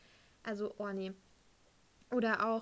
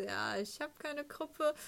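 higher, drawn-out reluctant reply; awkward admission; 5 semitones higher, much slower than usual. Retold or imitated. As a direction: own up sheepishly, stretching the words. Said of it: "Ich so". (verlegen gedehntes Eingeständnis)

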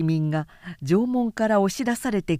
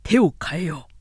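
decisive general; 3 dB louder than usual. Acted. Cao Cao decides new tactic.

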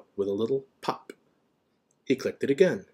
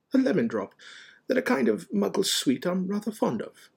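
genial wizard, higher pitch; Dumbledore; 11 semitones higher than usual. A narrator's reading. Dumbledore on his favourite Muggle sweet, genial. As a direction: explain pleasantly and unruffled.

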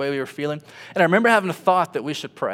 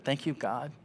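soft tearful thanks; 9 dB quieter than usual. Retold or imitated. Wife worshipping in tears.

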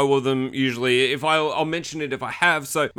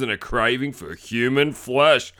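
ordinary talk

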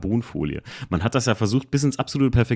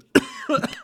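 sharp coughing fit; choking cough. Real, not acted. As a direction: cough from swallowing wrong. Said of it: "Jetzt hab ich ihn verschluckt".

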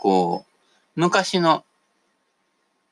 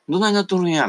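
put-on higher, louder Kansai accent; Osaka dialect; 10 semitones higher, 4 dB louder, faster than usual. Bewildered Kansai-accent exclamation on arriving in Osaka. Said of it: "みたいなね".

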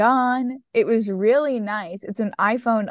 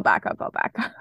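whispered; 13 semitones lower than usual. Real, not acted. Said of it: "I should back up".

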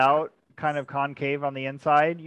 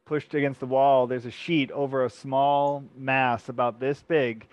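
ordinary delivery

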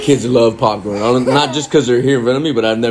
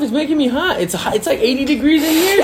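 much higher, playful voice; high-pitched voice; 13 semitones higher than usual. Acted. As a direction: lift the voice up high, teasing. Dad speaks to his child.

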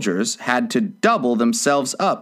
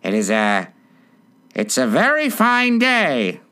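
timid voice. A narrator's reading. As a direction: venture small talk nervously. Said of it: "said a timid voice at her side".

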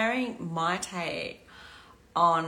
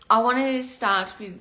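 usual speaking voice

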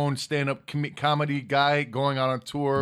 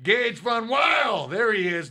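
hyped announcer; 5 semitones higher, slower than usual. Acted. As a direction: announce him like a ring announcer. Hyped shout-out name.

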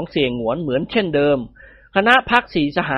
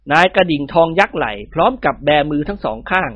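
ordinary delivery, nothing marked

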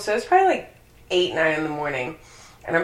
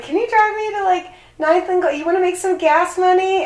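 much higher pleading mock lady voice; imitating the neighbor lady; 10 semitones higher than usual. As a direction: beg for a ride, pitched way up. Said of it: "but she was like".

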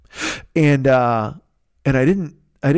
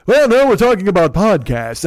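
louder, booming mountaintop voice; Fearful; 4 semitones higher, 4 dB louder than usual. Retold or imitated. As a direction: proclaim it as if from the mountain. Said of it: "I'm trying to do his, his, uh, on the mountain voice".

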